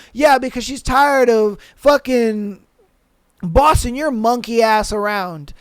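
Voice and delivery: normal talking voice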